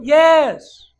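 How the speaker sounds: very loud, high yes; emphatic shouted yes; insistent God. Acted. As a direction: bellow the answer back. God shouting back, acted.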